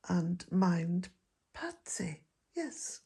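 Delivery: usual speaking voice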